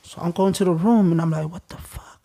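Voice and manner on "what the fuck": a stunned, barely voiced curse; stunned whisper. Alone in his cell, realizing the dead are family: shocked whisper. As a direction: breathe it out under your breath, shocked.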